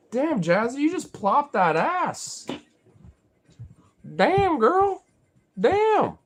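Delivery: high, loud whoop at a pet; playful high-pitched hype; mock amazement. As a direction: exclaim in a drawn-out high voice. Hyping up plopping pet.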